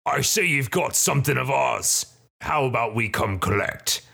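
put-on thug voice demanding what's owed; collect the debt as a hobgoblin boss; hobgoblin leader. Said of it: "the one in the middle says".

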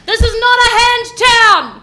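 loud, high rallying shout; battle cry. Acted. Player rallying against the cultists, loud shout. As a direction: yell it to rally everyone.

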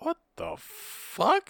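slow, high, drawn-out disgust; appalled. Retold or imitated. Patron appalled at the guy in the urinal. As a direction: stretch it out in disbelief. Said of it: "He's like".

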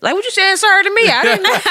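high, quick mock protest; Rebecca voice. Shown as a demonstration. Showing co-hosts her work voice, put-on Rebecca voice. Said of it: "I throw on my Rebecca voice".

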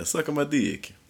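high-pitched mock Italian; bad Italian accent. Quarter-Italian man proving his point, bad Italian accent. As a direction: do the phony Italian accent as proof. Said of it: "they would say".

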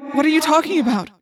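alarmed. A narrator's reading. Friend alarmed by her plea.

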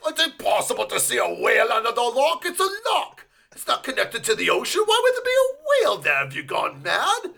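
high, fast, incredulous rant; mock exasperated sceptic. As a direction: scold the fool for seeing a whale. Acted exasperated sceptic quote.

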